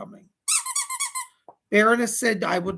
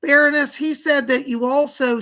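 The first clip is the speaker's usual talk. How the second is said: loud, high call of the name; indignant call; 6 semitones higher, 8 dB louder than usual. Real, not acted. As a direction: summon her sharply. Scolding the puppet's accomplice.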